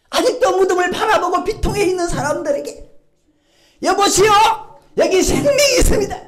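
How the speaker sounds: loud, high, impassioned sermon; fervent preaching. Acted. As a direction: proclaim it to the whole church. Preaching at full force.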